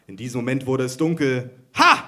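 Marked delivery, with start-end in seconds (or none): 1.7-2.0 high, loud triumphant "Ha"; triumphant shout. A narrator's reading. Micha crows at the blackout, loud and high. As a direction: crow it out in triumph. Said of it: "rief Micha".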